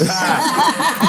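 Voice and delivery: laughing. Real, not acted. Laughing at joke.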